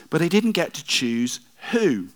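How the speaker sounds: ordinary delivery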